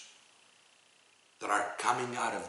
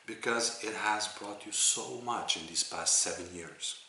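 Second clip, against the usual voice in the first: ordinary talk, nothing marked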